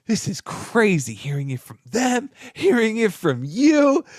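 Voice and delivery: mildly hammed-up disbelief, leaning on the contrast; retold amazement. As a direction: imitate his amazement, pitch climbing. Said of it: "this guy was just so amazed".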